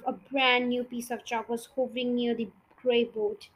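ordinary delivery, nothing marked

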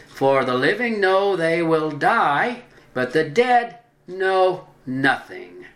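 deliberate quote, pausing around each key word; emphatic scripture reading. Acted. Preacher citing the wisest man on the dead, emphatic.

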